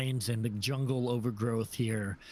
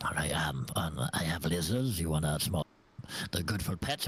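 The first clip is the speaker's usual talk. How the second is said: secretive raspy sales murmur; 7 semitones lower than usual. Acted. GM voices shady tiefling merchant.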